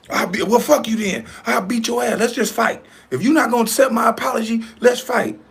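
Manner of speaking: high-pitched mimicry of someone picking a fight; mock challenger. Host acting out the man offering violence over an apology, high mocking imitation. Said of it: "the one that's being offered the violence".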